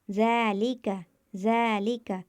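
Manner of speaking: ज़ालिका with the ज़ा held for one alif's length; recited with stretched vowel. Shown as a demonstration. Teacher reciting the example ज़ालिका, first vowel stretched. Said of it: "एक अलिफ के बराबर खींचकर पढ़ेंगे".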